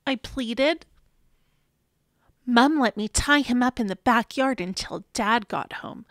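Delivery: usual speaking voice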